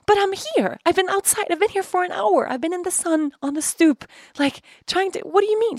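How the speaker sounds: high-pitched, insistent protest; retold meltdown. Daughter waiting outside argues with mom, near meltdown. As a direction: pile up the complaints, worked up. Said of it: "complete meltdown".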